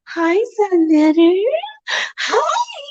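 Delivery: sweetest, sexiest voice. Retold or imitated. Mocking the married woman flirting with Sonetter, slow syrupy high voice. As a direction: ham up a syrupy flirty hello, the last hi squeaked up high. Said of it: "putting on her sweetest, sexiest voice".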